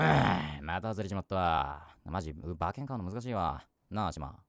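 low, slow rough gambler lament; a hint of a bitter punter; acted gambler grumble. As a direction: moan like a loser at the racetrack. Acted drama scene, losing bet.

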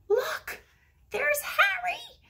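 high, loud, slow excited shout. A narrator's reading. Tom spotting his dog across the lawn, excited high shout. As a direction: yell it out, thrilled to spot the dog. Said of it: "shouted Tom".

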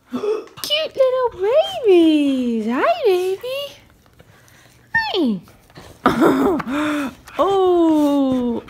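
high, loud, slow pet voice; cooing at pets. Real, not acted. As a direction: coo at the puppies up high. Greeting pets in baby talk.

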